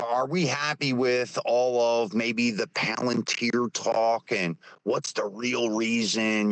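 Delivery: ordinary talk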